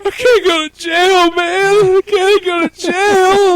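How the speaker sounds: high, slow mock whimper; whining drunk. Drunk man begging not to be jailed, high mock whine. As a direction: plead like a blubbering drunk. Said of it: "he's just like".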